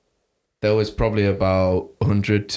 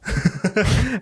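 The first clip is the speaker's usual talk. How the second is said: high laugh; 13 semitones higher than usual. Real, not acted. Laughs at own illness.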